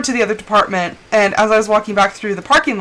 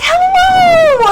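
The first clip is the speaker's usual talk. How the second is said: very high, loud singsong hello; imitated exuberant greeting; 6 dB louder than usual. Coworker greeting people who come by, exaggerated exuberance. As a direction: sing it out, hammed-up and piercingly high. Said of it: "she's like".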